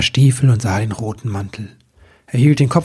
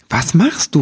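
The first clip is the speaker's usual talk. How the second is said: aufgeregter, höherer Ausruf; 5 semitones higher than usual. A narrator's reading. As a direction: ruf es aufgeregt heraus. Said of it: "rief Nibelwurz aufgeregt". (aufgeregt rufend)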